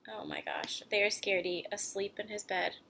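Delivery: plain reading voice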